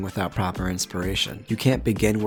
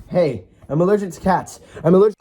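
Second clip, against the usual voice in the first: raised, urgent objection; loud protest; 9 semitones higher, 9 dB louder than usual. Acted. Interrupting a skit about holding a cat, loud protest. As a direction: call out in alarm, high and loud.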